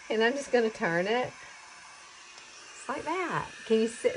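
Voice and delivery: normal talking voice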